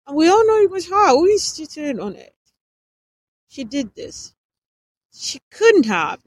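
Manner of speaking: high, loud blaming tone; angry accusation. Acted. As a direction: lash out at her loudly. Nathan accuses angrily.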